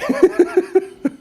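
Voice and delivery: a sudden high laugh breaking into the quote; high burst of laughter. Real, not acted. Laughs at own mock quote.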